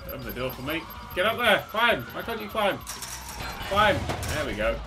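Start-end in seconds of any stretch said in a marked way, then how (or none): 1.1-4.0 shouting. Real, not acted. Yelling at stuck character.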